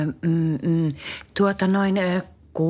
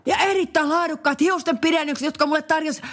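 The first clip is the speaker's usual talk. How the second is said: ad read; 9 semitones higher than usual. Acted. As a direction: sell it like a cheesy cruise ad. Ad read sponsor plug. (mainoslukijan ääni)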